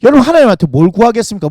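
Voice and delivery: ordinary delivery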